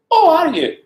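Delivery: high, loud cooing surprise; overawed politeness. Retold or imitated. Hairdresser meeting the Queen's P A, high overawed cooing. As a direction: coo it, hammed-up and far too high.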